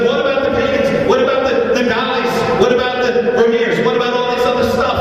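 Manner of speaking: high, indignant clamour; protesting crowd. Retold or imitated. Rich bidders protesting to the auctioneer, high and indignant.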